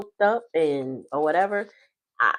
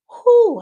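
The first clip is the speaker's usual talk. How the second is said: high loud whew; 13 dB louder than usual. Real, not acted. Relief after choking feeling.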